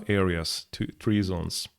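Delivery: normal talking voice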